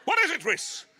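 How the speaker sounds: high, loud alarmed bark; alarmed shout. Retold or imitated. Dad charges in with machete, loud high alarmed shout. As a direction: shout it like a panicked dad bursting in.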